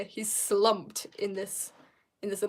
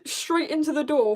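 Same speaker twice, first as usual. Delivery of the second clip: ordinary delivery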